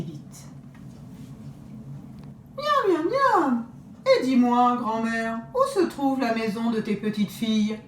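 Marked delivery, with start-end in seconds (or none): 2.6-7.8 high, smacking greedy wolf. Acted. Wolf quizzing grandmother about her granddaughters, greedy and sly. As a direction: lick your chops and pry sweetly.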